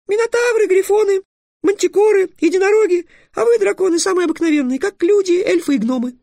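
very high squeaky tirade; scolding fairy. Acted. Fairy listing magical beings to the dragon, furiously scolding. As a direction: rattle off indignantly in a tiny piping voice.

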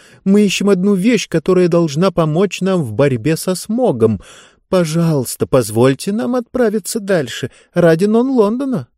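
put-on polite girl making an urgent plea; pleading girl. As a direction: implore them to let you go on.

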